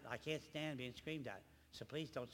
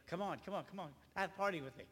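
higher, insistent coaxing; mock coaxing; 6 semitones higher, 5 dB louder than usual. Host begging people to join a pity party, mock coaxing. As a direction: play someone urging reluctant guests to join.

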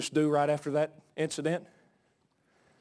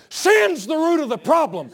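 shouted; 12 semitones higher, 9 dB louder than usual. Real, not acted. Repeated shouted line.

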